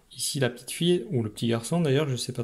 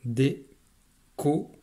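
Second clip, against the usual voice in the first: drawn-out syllables. Shown as a demonstration. Teacher sounding out a word for a child, drawn out. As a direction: slowly stretch out the syllables. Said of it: "le mot à découvrir".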